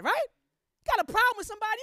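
ordinary talk

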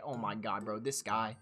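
quiet exasperated aside; muttered disbelief. Real, not acted. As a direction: shake your head at the cringe. Reacting to cringe chat line.

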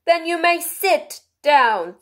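high, slow, loud royal command; a ringing, drawn-out kingly voice. A narrator's reading. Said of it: "the king replied".

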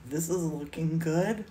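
low, amused self-satisfaction; pleased self-quote. Retold or imitated. Telling babe what she thought of her own painting, low and pleased. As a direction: imitate your own private approval, dropping low. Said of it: "I was like".

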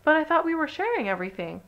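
high-pitched puzzled protest; surprised and hurt. A narrator's reading. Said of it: "in surprise".

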